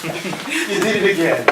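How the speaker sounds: ordinary talk, nothing marked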